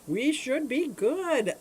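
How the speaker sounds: high, slow, drawn-out sing-song; sing-song reassurance. Real, not acted. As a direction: stretch it out cheerfully.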